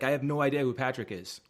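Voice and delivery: usual speaking voice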